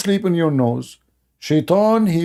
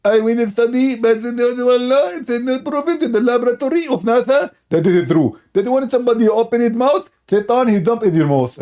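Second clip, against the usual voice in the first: pitched-up parody of a rival debater; mocking Zakir Naik; 6 semitones higher than usual. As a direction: lampoon a smug preacher, pitched up. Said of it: "Okay, Zakir Naik".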